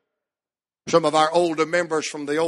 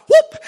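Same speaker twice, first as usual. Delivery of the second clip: sudden loud, high whoop; exultant whoop; 10 dB louder than usual. Real, not acted. Preacher overjoyed by the scripture, high whoop. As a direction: let out a short revival whoop.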